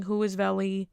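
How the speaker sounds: ordinary talk, nothing marked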